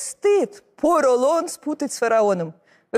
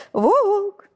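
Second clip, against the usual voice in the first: rising call, pitched up high; 7 semitones higher than usual. Acted. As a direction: call the wolf from far away. Calling the wolf to come.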